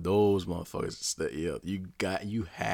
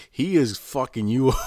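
laughing; 6 semitones higher, 8 dB louder than usual. Real, not acted. Laughing at the story.

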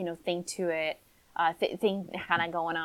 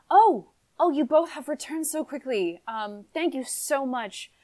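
a touch higher, grateful noblewoman; relieved lady; 6 semitones higher, 4 dB louder than usual. Acted. Mother thanking the returning helpers, mildly flustered.

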